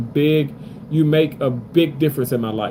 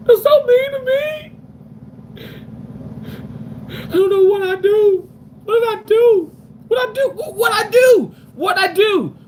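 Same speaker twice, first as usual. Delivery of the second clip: mock crying. Streamer fakes tears at mean chat, hammed-up sobbing. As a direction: overdo a fake crybaby breakdown.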